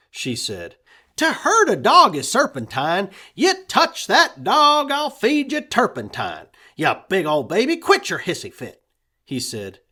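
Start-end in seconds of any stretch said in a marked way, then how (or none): 1.2-8.8 high, loud defiant threat; fierce boy. A narrator's reading. Boy threatening Red to protect his puppy, high and fierce. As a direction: stand up for the dog.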